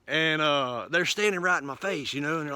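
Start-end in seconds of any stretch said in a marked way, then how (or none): none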